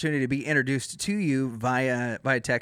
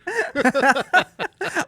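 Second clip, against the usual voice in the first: loud laugh; 13 semitones higher, 6 dB louder than usual. Real, not acted. Reacting to Lucy joke.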